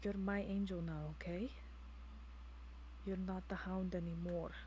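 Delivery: hushed, low, soothing voice; tender reassurance. Acted. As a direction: softly coax a loved one back to safety. Soothing a frightened transformed companion.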